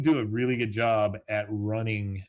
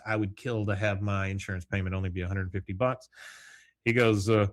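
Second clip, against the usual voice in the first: ordinary talk, nothing marked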